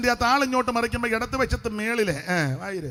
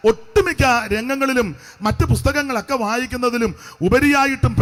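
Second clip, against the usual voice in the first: emphatic, rhythmic pulpit delivery; preaching; 2 semitones higher, 10 dB louder than usual. Real, not acted. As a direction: preach it out to the congregation, phrase by phrase. (ഊന്നിപ്പറയുന്ന സുവിശേഷ പ്രസംഗം)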